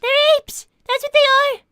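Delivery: insistent correction. Acted. Arguing apes vs monkeys.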